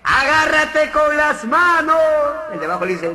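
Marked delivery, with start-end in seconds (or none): 0.0-2.0 agudo, lento y fuerte; voz de personaje. Acted. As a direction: gritarle al de abajo desde arriba. Personaje de arriba grita. (el de arriba grita hacia abajo, lento y agudo)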